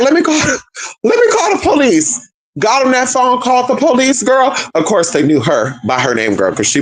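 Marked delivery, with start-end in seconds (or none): 0.0-2.2 high-pitched mock urgency, breaking up; imitated urgent grandma. Grandma after hitting him, high mock-urgent call. Acting grandma calling police.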